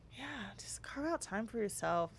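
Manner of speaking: whispered softly; whispered advice. Real, not acted. Host gives listeners a whispered closing tip. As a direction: let it fall to a gentle whisper. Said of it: "soft closing advice".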